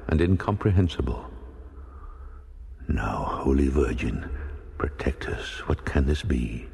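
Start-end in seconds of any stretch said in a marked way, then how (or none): 2.9-6.7 low, hushed, fearful prayer dropping to a breathy whisper on protect us; a frightened captain praying under his breath; frightened exclaim. Acted. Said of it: "exclaimed the captain in a fright".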